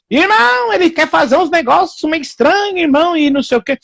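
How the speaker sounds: high, whining imitation of a worried husband; whiny complaint. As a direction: mimic a fretful brother complaining. Homem casado se queixando ao pastor. (voz aguda e chorosa de irmão imitado)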